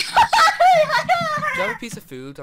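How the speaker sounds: shrieking, high and slow; frantic high shout. Acted. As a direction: yell it out in a panic. Poisoned in game, shouting.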